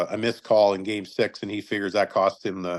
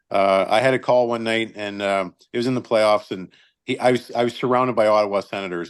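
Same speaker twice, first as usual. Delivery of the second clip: ordinary talk, nothing marked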